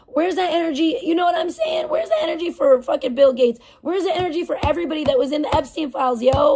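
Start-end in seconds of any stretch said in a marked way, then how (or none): 0.1-5.4 rising indignant yell; angry rant. Real, not acted. Ranting about outrage double standards.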